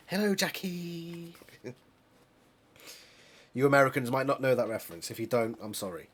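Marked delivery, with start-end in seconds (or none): 0.1-1.4 slow, higher, hammed-up call to his wife; Friday Night Dinner dad. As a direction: drag it out fondly like the sitcom dad. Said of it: "Reminds me of, um, Friday night dinner".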